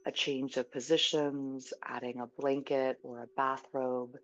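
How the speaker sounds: soft, unhurried meditation guidance; guided meditation. Real, not acted. Teacher settling a group into stillness before a sit, soft guided meditation. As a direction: ease them gently into stillness.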